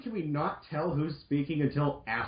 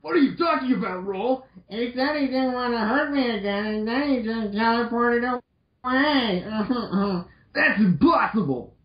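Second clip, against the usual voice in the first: higher, put-on girlish dismay; mock distraught Roll; 6 semitones higher than usual. Roll hearing Mega Man teleported away, mock distress. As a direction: play up her shock and despair.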